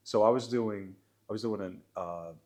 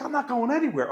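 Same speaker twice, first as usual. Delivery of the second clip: higher, stubborn heckler refusing to leave; defiant old man; 14 semitones higher, 6 dB louder than usual. Retold or imitated. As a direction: dig in, refuse to budge. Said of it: "And he was like".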